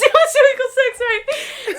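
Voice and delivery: high, loud, laughing repeat; helpless high-pitched laughter through the words; laughing hard. Real, not acted. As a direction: crack up while echoing the joke. Laughing at a friend's silly remark.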